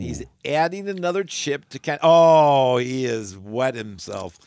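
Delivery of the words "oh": louder startled exclamation; shocked at his face; loud surprised oh. Real, not acted. Reacting to the spicy chip.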